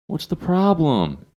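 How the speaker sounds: high, slow drawl, each word stretched long; imitating the biker's slow drawl; drawn-out words. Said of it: "he just drew his words out very long".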